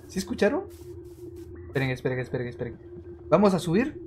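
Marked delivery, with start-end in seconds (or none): 1.7-2.8 softer and lower, hushing everyone; quiet "esperen" to hold the group still; hushed. Real, not acted. Streamer hushing viewers while listening for ghosts, hushed.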